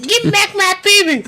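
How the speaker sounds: high falsetto cartoon quote; cartoon impression. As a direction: shoot it up into falsetto. Quoting a South Park line.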